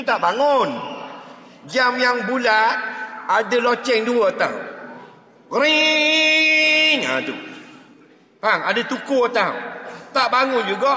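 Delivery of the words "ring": high loud mock alarm ring; alarm clock ring. Imitates the alarm clock ringing. (bunyi loceng jam yang nyaring)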